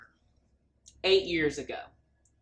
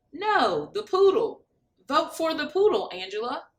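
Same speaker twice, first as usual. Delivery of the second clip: high, insistent mother pushing for the poodle; a mother pressing her daughter, voice raised; mother's voice; 5 semitones higher, 3 dB louder than usual. A narrator's reading. Mother urging Angela to pick the poodle, higher voice. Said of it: "the mother says".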